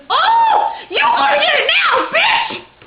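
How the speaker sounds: very high, loud shriek; furious threatening scream. Retold or imitated. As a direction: imitate an enraged girl giving chase. Retold girl screaming threat while chasing.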